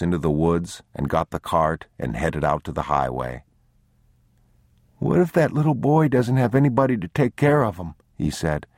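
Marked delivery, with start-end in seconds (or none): 5.0-7.9 put-on high, quick child's question; worried little boy. A narrator's reading. Boy fretting over the other child, high worried questioning. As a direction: ask anxiously in a child's pitch.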